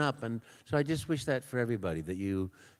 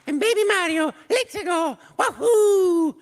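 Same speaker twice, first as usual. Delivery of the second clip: very high baby cartoon voice; baby Mario voice; 8 dB louder than usual. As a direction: squeal it like a toddler plumber.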